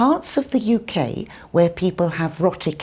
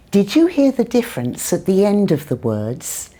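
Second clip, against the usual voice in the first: ordinary talk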